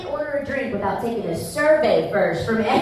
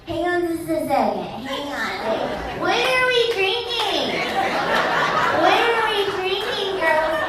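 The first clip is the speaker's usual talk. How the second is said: put-on high, ditzy party girl voice; 6 semitones higher than usual. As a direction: play the tipsy girl holding up the bar. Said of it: "So it goes like this".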